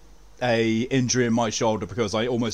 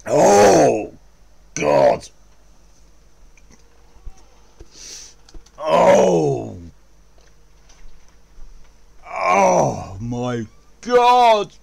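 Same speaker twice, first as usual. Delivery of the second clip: pained yelling; 5 semitones higher, 6 dB louder, much slower than usual. Real, not acted. In-game pain, yelling out.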